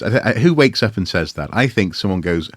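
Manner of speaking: ordinary talk, nothing marked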